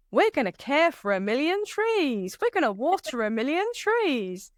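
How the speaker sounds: put-on sing-song American accent, lifted high. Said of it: "that was my American accent".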